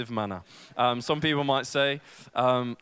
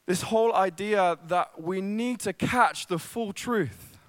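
a touch higher, earnest sermon build; preaching; 6 semitones higher, 3 dB louder than usual. Acted. Pastor to congregation about the gospel, earnest preaching. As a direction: lift the room toward the message.

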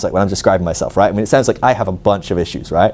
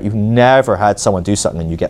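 usual speaking voice

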